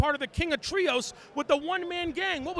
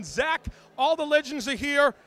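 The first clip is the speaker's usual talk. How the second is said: ordinary talk, nothing marked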